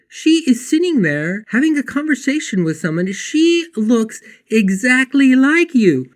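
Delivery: high, loud, astonished telling; shocked. Retold or imitated. Telling Claudia about her lookalike, astonished. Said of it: "I'm shocked".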